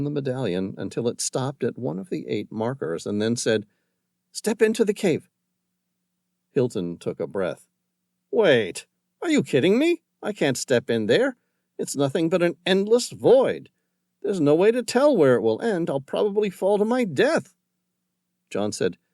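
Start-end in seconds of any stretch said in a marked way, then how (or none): 4.3-5.3 commanding. Acted. John commands. 8.3-17.5 panicked protest. Acted. Hilton panics at void.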